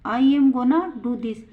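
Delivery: slow, clear example for students; teacher reading it out slowly to the class; slow model sentence. Shown as a demonstration. Teacher models the sentence, slow and clear. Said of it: "example क्या?".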